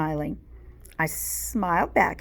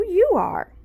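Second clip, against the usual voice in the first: put-on much higher, loud childish retort; high whiny retort; 13 semitones higher, 7 dB louder than usual. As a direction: fire it back, high and loud. Kids bickering, Chloe retorting.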